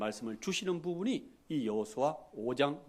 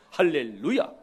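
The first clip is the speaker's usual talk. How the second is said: loud hallelujah; 6 dB louder than usual. Real, not acted. Preacher's exclamation.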